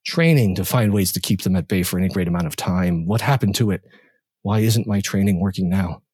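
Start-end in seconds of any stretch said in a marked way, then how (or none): none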